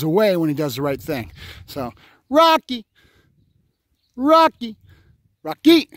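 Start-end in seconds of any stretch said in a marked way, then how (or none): 2.3-5.9 higher, louder repeated name calls; calling the dog. Real, not acted. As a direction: call him in, again and again.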